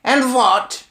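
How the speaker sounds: high, loud witch's shout; Grand High Witch shout. Acted. Witch leader shouting a question to her audience. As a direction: bark it out like a commanding witch. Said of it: "shouted the Grand High Witch".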